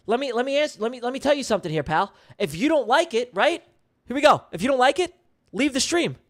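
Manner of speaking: high, heated telling-off; getting mad. Real, not acted. Streamer telling a heckler to leave, getting mad. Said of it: "I'm getting mad".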